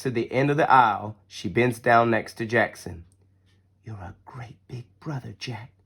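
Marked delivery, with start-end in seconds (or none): 3.8-5.7 soft mother's whisper to her son. Acted. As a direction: breathe it close to his ear. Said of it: "she whispers".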